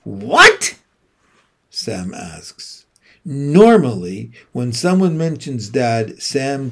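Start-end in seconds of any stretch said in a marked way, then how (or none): 0.0-0.8 very high, loud yelp; shocked what. Acted. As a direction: gasp it in shock. Sam shocked.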